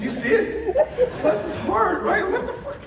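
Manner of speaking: high, laughing disbelief. Real, not acted. Father joking to the audience about his son, laughing. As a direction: talk through the giggles.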